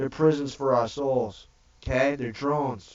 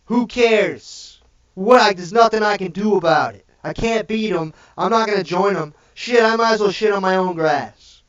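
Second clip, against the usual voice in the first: higher and louder, ranting in mock despair; fed-up shout; 4 semitones higher, 5 dB louder than usual. Ranter voicing the fed-up man, loud and higher. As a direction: throw your hands up and yell it out. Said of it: "you're like".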